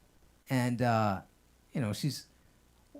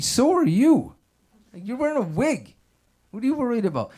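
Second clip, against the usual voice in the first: exasperated; 8 semitones higher, 4 dB louder than usual. Retold or imitated. Exasperated reply to grandma.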